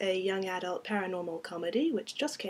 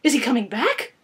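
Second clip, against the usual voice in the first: raised, loud frightened question; hyperventilating panic; 4 semitones higher, 11 dB louder than usual. Acted. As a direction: ask in mounting dread. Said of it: "I was starting to hyperventilate".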